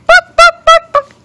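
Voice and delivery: high, loud, staccato clucks; chicken clucking. Pretend. Mother playing chicken for baby, high loud clucking. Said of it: "That's a chicken".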